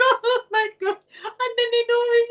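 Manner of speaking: high, panicked squeal; freaking out. Retold or imitated. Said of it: "Freaking out".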